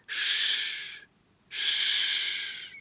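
soft hushing hiss; soft shushing. A narrator's reading. Mother hushes the startled, crying baby, soft shush. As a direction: hush the crying baby gently. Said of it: "Ihr leises Zischen".

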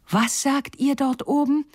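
eager call upward. Acted. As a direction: call up to the birds. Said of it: "rief Gerda".

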